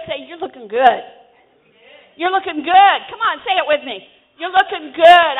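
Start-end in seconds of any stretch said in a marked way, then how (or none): 0.3-3.0 loud preacher's call to repeat; rousing declaration. Shown as a demonstration. Preacher leading congregation to repeat. 4.4-5.3 loud, higher preacher's affirmation; rousing declaration. Shown as a demonstration. Preacher leading congregation to repeat.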